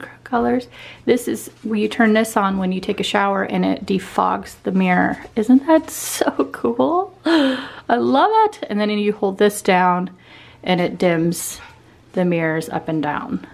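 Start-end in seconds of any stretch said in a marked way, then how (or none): 5.4-8.7 slow, high, loud gushing; delighted gasp. Real, not acted. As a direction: swoon over the mirror. Gasping at the mirror.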